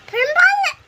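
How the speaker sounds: high, loud excited cry; excited squeal. Real, not acted. Reacting to sour candy.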